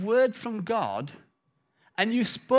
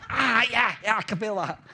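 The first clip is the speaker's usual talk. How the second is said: mock pained yelp. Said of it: "Let's see how far you can feel that thing".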